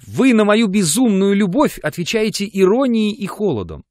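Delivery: высоко и громко, с рыданием в голосе; выплакивает обиды, навзрыд; рыдая. A narrator's reading. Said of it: "продолжала она, рыдая". (рыдая, жалуется Жоржу высоко и громко)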